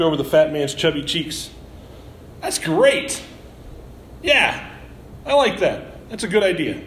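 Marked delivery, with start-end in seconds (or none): 2.4-6.8 high, breathy burst of delight; light up at the idea, pitched up; excited higher voice for the delighted owner; delighted diner owner. Acted. Delighted diner owner likes plan.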